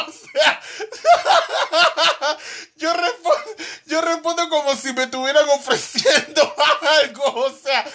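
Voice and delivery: hablando entre risas agudas; riendo. Real, not acted. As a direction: decirlo muerto de risa. Se ríe de sí mismo. (entre carcajadas agudas)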